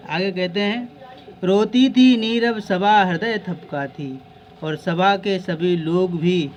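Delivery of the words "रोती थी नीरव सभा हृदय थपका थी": slow, louder and higher verse reading; teacher reciting the line to the class, slow and raised; poem recitation. Acted.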